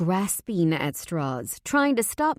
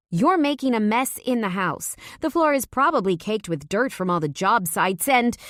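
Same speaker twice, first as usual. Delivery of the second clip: scolding; 5 semitones higher, 4 dB louder than usual. A narrator's reading. Talia nagging Leo.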